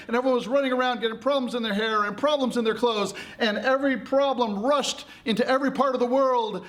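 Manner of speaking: high, breakneck storytelling; frantic narration. A narrator's reading. Narrator as problems rush into the world, frantically.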